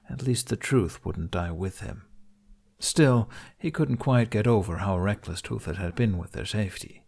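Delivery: plain reading voice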